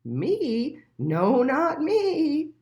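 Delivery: a dreamy goat waking up. A narrator's reading. The goat denying it to Little Mole, high and slow.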